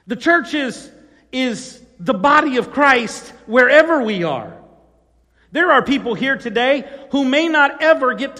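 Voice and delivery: preacher rallying the church, in full voice; full-force preaching. Real, not acted. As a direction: drive it home to the congregation.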